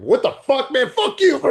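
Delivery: high, loud mock yell; hammed-up shouted retort; mock outburst. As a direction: explode at the driver in fun. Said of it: "you go".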